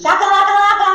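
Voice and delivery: high, loud nonsense call; playful word play. Shown as a demonstration. Teacher leads imagination warm-up, loud high silly voice. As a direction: throw it out big and high.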